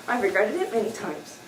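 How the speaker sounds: ordinary delivery, nothing marked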